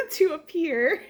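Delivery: words bubbling out through a laugh. Real, not acted. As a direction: laugh as you say it, delighted. Laughs while showing baby's toys.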